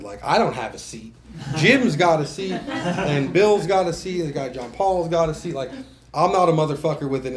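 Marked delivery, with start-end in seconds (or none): 1.6-5.5 louder, higher, laughing through the list; laughing as he lists the regulars' seats; laughing mockery. Retelling seat owners in the meeting, laughing loud. Mocking others' assigned seats.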